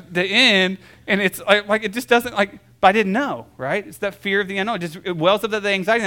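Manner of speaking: normal talking voice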